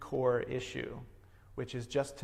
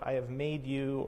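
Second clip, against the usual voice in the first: usual speaking voice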